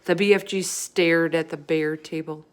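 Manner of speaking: ordinary delivery, nothing marked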